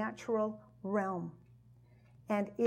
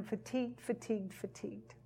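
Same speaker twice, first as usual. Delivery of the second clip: each fatigued much softer, dying away; fading repetition; 10 dB quieter than usual. Pretend. Weary believer fades as he repeats fatigued, dying away. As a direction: let the word sink into exhaustion. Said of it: "repeated word fading out".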